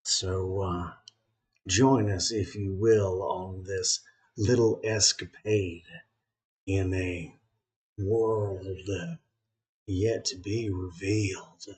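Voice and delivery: put-on Southern accent welcoming the folks at home. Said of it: "the round robin introduction with this accent".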